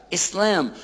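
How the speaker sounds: ordinary talk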